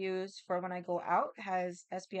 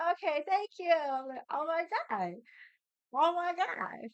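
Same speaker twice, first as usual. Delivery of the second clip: high, loud, giddy thanks; giddy oh my God over a friendly stranger; delighted squeal; 9 semitones higher, 4 dB louder than usual. Retold or imitated. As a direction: squeal like the nicest thing just happened. Thrilled by friendly receipt checker.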